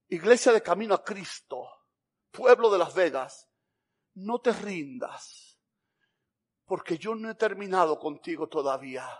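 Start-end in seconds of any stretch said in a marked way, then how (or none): 4.1-9.1 soft, very slow, earnest encouragement; slow right down and reassure softly; solemn reassurance. Acted. Jesus telling the church not to give up, solemn reassurance. God's word of encouragement, preached.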